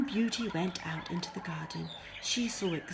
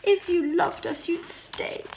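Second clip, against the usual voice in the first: whiny guilt trip; 10 semitones higher, 8 dB louder than usual. Acted.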